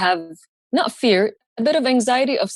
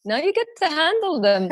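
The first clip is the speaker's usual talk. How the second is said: gloating; 5 semitones higher than usual. Retold or imitated. Gloating at brother.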